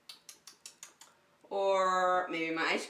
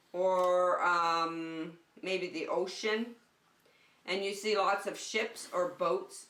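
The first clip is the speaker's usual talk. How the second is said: ordinary delivery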